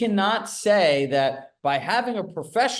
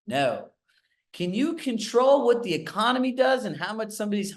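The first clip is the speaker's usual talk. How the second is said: put-on Southern accent; 7 semitones higher than usual. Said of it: "amazing Southern accent".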